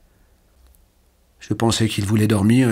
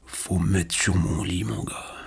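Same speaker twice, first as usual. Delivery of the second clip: softer, slow, fading to a breath; faint dying voice; 5 dB quieter than usual. A narrator's reading. Paralysed old man asking Jean to lift him, faint. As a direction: let the words thin out to almost nothing. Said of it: "de sa voix de plus en plus claire".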